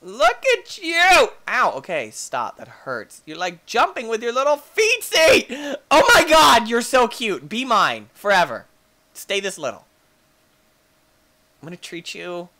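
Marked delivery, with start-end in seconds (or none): 0.0-1.3 slow, very high and loud coo; drawn-out high baby-talk. Pretend. Cooing at baby dino. 3.3-9.8 higher, louder doting gush; excited doting. Real, not acted. Gushing over cute baby dino.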